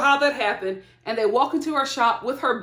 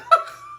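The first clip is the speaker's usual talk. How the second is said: shrieking burst of laughter; high squealing laugh. Real, not acted. Bursting out at the scene.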